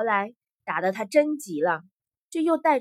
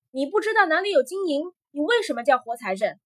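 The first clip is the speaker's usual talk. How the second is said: loud, higher, exasperated questioning; impatient; 5 semitones higher, 5 dB louder, faster than usual. A narrator's reading. Emperor to the man who knows of no silver, impatient. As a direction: press him impatiently, voice rising. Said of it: "皇帝急了".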